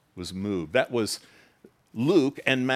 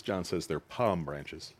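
usual speaking voice

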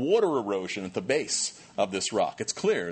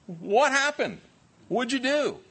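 high, louder demanding questions; incredulous Moses; 12 semitones higher, 3 dB louder, slower than usual. Retold or imitated. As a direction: Moses confronting his brother in disbelief. Moses confronts Aaron.

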